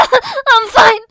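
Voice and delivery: high, loud sobbing cry. Acted. Wounded sister sobs reassurance to her brother. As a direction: force it out through sobs. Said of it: "she sobbed".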